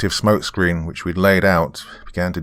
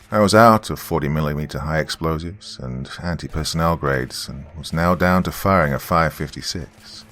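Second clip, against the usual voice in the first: plain reading voice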